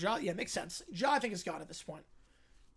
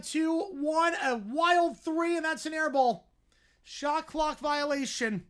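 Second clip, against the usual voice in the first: higher, louder countdown call, key words stretched; excited play-by-play; 9 semitones higher, 9 dB louder, much slower than usual. Real, not acted. Commentator calls a wild three and air ball, excited play-by-play. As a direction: build urgency as the shot clock expires. Calls the shot clock running out.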